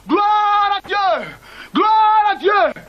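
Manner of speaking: high, slow, joyful praise. Acted. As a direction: draw out the thanks joyfully. Praising after the birth.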